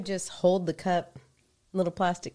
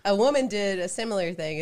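normal talking voice

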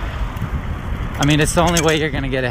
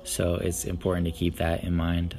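subdued reflective; 7 semitones lower, 9 dB quieter than usual. Real, not acted. Reflective soft monologue.

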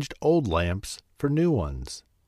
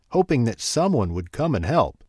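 plain reading voice

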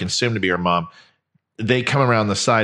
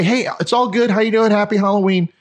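high, upbeat hello to a stranger; retold friendly greeting; 9 semitones higher than usual. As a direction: reenact a cheery, disarming greeting. Acts out friendly greeting to driver.

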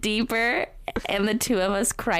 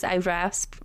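plain reading voice

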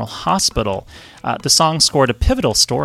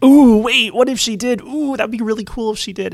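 high excited gasp, much higher; 12 semitones higher, 3 dB louder than usual. Retold or imitated. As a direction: squeal it out in delight. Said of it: "I'm like".

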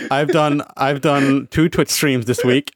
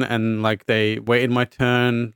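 ordinary talk

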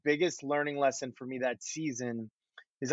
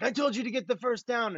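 a higher, half-hearted imitation of a yelling coach; mock coach yell; 8 semitones higher than usual. Quarterback imitating Mike Holmgren yelling at him on the sideline, mock yell. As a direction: bark it like the head coach, lightly. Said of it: "he'd yell something like".

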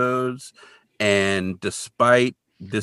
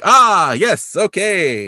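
higher, louder, drawn-out mock realization; mock acknowledgment; 9 semitones higher, 6 dB louder, much slower than usual. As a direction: act out taking in bad news. Kolchak learns he is in trouble.

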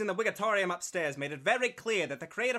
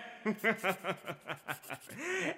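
evil chuckle; 3 semitones higher, 5 dB quieter than usual. Acted. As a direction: chuckle like a scheming villain. Villain gloats over signed contract.

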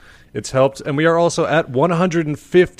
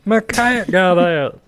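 cartoon character voice; 7 semitones higher, slower than usual.

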